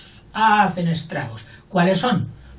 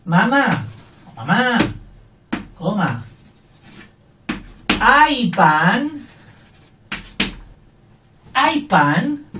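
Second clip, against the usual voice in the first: calling to mother; 4 semitones higher, much slower than usual. Retold or imitated. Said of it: "Cuando dices tú a tu madre".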